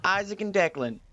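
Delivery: host introducing the cast; show intro announcer. Acted.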